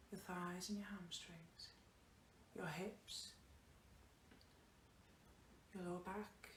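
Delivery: soft, low-key meditation voice with long pauses; guided body scan. Acted. Yoga teacher leading students through a body scan, soft guided meditation. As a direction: guide them gently down the body, hushed and unhurried.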